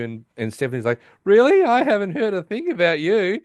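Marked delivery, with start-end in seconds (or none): 1.2-3.4 high, loud, incredulous opening; mock affronted, pitched well up; indignant retort. Girlfriend confronts the woman across the hall, indignant.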